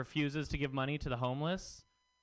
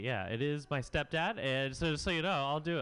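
ordinary talk

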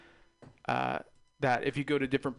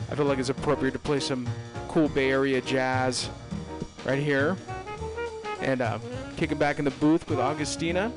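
laid-back radio host patter, slowing on the number; easygoing on-air DJ plug. Acted. Radio host plugs jazz and call-in.

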